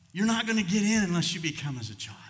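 loud, high preached line; preaching at full force. Acted. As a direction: proclaim it to the congregation. Preaching climax.

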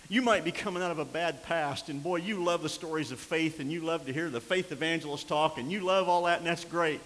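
loud, high, hammering sermon delivery; preaching at full force. Acted. Preacher warning the congregation about returning spirits, full-force preaching. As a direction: preach it like a revival. Preacher lifting the energy again.

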